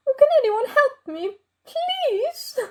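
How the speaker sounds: very high plea, fading to a whimper; tearful pleading. Acted. Stranded chair begging anyone to help, tearful pleading. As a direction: beg for help, voice climbing high on please.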